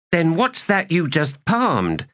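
high, slow accusing question. Acted. A player challenges a rival at dice, accusing. Quarrel over cheating at dice.

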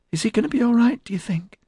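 put-on very high girlish squeak; high-pitched, squeaky. A narrator's reading. Tiger Pansy asks after J C, squeaky.

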